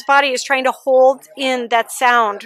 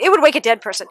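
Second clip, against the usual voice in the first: normal talking voice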